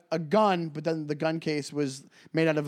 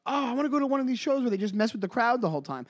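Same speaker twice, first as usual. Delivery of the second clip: higher, fast excited voice; eager fan; 8 semitones higher, faster than usual. Retold or imitated. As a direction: gush like a new fan. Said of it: "you're like".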